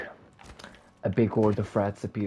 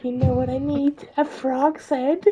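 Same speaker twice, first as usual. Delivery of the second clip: witch voice; 8 dB louder than usual. Acted. Witch lists her ingredients to Cinderella in a high cackling screech. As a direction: list the ingredients like a gleeful hag. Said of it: "the witch says".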